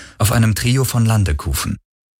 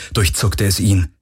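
ordinary delivery

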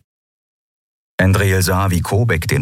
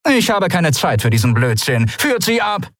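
high final order; curt dismissal; 9 semitones higher than usual. A narrator's reading. Captain turns away and orders them taken, high and curt.

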